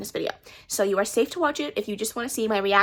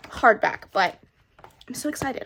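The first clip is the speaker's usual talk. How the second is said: ordinary delivery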